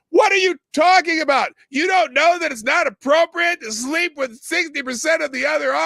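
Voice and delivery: loud, high-pitched shouting rant; angry rant. Real, not acted. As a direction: yell it out in real outrage. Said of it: "Sorry, I'm ranting".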